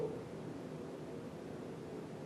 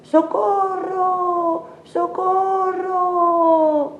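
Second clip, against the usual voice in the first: long, very high frightened calls; cry for help; 13 semitones higher, much slower than usual. A narrator's reading. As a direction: stretch out each cry like a scared little girl. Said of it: "y grita". (gritos de auxilio, muy agudos y largos)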